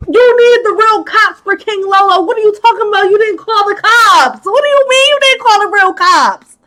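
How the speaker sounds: high-pitched exasperated questioning; incredulous outburst. Real, not acted. Recapper scolding Alyssa for not calling cops, incredulous. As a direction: demand an explanation in disbelief.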